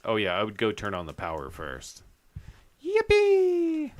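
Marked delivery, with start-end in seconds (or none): 2.8-3.9 high-pitched gleeful yippee; excited squeal. Real, not acted. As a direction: squeal it with delight. Gleeful cheer in horror game.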